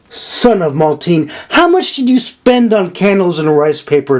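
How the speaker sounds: high, sharp scolding demand; angry demand. A narrator's reading. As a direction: scold him for the wasted money. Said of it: "Nora demanded".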